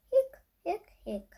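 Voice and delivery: high little hiccups; hiccup sounds. Acted. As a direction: hic out each one like a hiccup. Skeleton has the hiccups.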